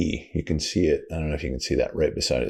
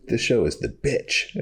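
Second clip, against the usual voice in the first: imitating kid; 7 semitones higher than usual. Friend's son quoted.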